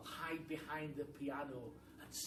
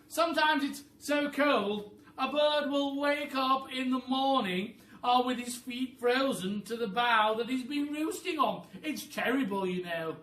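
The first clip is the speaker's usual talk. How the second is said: high, loud bossy bird voice; a high, loud, clever-bird voice; roly-poly bird voice; 10 semitones higher, 16 dB louder than usual. Acted. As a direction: squawk it like a know-it-all bird. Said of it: "said the roly-poly bird".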